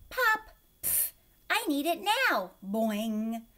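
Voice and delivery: high loud read with pop and boing; silly high read-aloud with sound effects; reading with punctuation sounds. A narrator's reading. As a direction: trudge through adding every noise.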